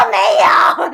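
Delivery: loud crying snap of a no; retold sobbing shout; tearful shouted denial. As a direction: bark it through tears. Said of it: "我说".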